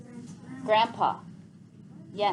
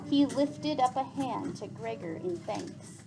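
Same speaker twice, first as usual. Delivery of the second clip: soft, fading storytelling; story narration; 5 dB quieter than usual. Acted. Teacher reading the farewell scene aloud, softening narration. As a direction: let the scene settle quietly. Teacher reads novel to class.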